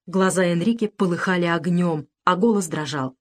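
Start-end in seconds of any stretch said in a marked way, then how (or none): none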